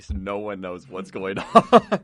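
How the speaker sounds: laughing. Real, not acted. Laughing at own story.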